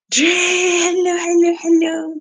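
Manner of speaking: loud, high-pitched, drawn-out hello; calling out delighted to a viewer; excited high greeting. Real, not acted. Greeting a viewer on the live stream, excited high call.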